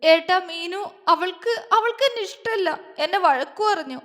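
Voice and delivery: high, halting tearful complaint; tearful, lips trembling. A narrator's reading. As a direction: whimper it out on the verge of sobs. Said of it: "അവളുടെ ചുണ്ട് വിതുമ്പിപ്പോയി".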